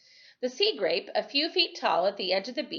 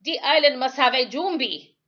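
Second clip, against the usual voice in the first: put-on grumbling old islander; 5 semitones higher, 7 dB louder than usual. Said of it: "Timothy grumbled".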